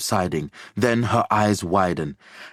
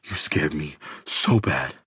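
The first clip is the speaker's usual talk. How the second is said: shaken relief; 5 semitones lower, 3 dB quieter than usual. A narrator's reading. Relief after scare.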